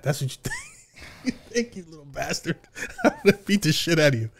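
soft threat broken up by laughter; laughing mock threat. Father imitating grandpa threatening his son, laughing. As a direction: play grandpa's outrage while cracking up.

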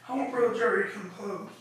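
ordinary delivery

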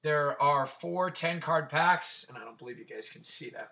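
normal talking voice

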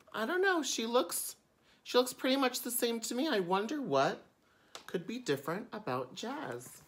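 mock-puzzled storytime aside. Real, not acted. Aside questioning the picture playfully.

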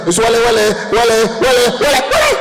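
loud, high chant of wale, ending in a high rise; fake animal talk. Boy trying to speak to a dog, high chant. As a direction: mimic talking to a wild dog. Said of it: "I was like this".

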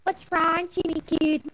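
high seductive mock voice; quoting a TikTok skit in a high teasing voice; TikTok voice quote. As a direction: tease like the TikTok character. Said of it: "there's this one thing".

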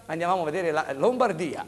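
normal talking voice

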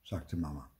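usual speaking voice

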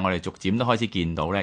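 usual speaking voice

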